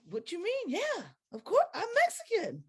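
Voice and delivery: high, puzzled, a touch incredulous; high voice, baffled of course; puzzled reply. Retold or imitated. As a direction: answer as if it's obvious. Her retold reply, high far voice.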